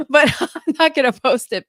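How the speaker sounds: the promise nobody believes, giggling; laughing. Retold or imitated. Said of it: "I mean, I might".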